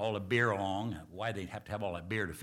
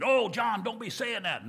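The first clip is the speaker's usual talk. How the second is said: higher, louder pleading cry; pleading protest; 10 semitones higher, 5 dB louder than usual. Retold or imitated. Congregation begging their pastor not to leave, high pleading. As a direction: beg him not to say it.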